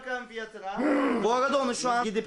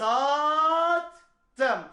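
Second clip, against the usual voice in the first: high, drawn-out sold call; auctioneer; 5 semitones higher than usual. Acted. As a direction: bring the hammer down. Hammer down. (mezatçı gibi "sattım")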